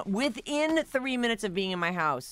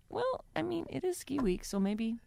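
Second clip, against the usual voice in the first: high, softer hedging voice; tentative excuse; 9 semitones higher, 5 dB quieter than usual. Retold or imitated. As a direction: offer a doubtful excuse, higher and quieter. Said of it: "And I was like".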